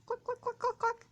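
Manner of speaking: high clucking calls; hen clucking. A narrator's reading. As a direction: cluck to call the chicks. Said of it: "she would call her little chicks".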